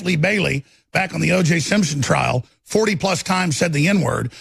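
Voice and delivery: normal talking voice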